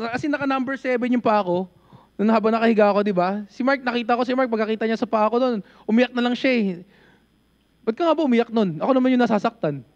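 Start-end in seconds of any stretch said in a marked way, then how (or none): none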